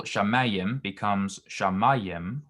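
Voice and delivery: shamayim becomes shamayim, slowly, the second with a lengthened vowel; pausal vowel lengthening demo. Shown as a demonstration. Tutor slowly demonstrates the pausal form shamayim to a student. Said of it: "For example".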